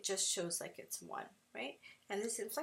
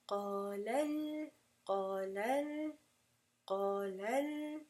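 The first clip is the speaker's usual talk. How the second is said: "qala al-" drawn out slowly, second syllable stepped up and held; inflected nabr; slower than usual. Shown as a demonstration. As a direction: show the inflection on "al-", slow and sustained. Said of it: "You have to make an inflection of sound".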